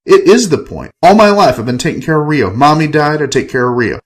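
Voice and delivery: exasperated outburst. Acted. Enrique venting to Ava about Rio, exasperated. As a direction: pour out years of resentment. Narrator erupts about caring for brother.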